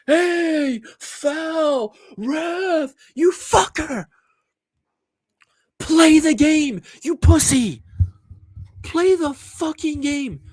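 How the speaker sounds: hammed-up footballer wailing for a foul, slow on "Play the game"; mock whiny complaining player. Mimicking Sané screaming at the ref after a tug. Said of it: "every time this guy Leroy Sané gets touched".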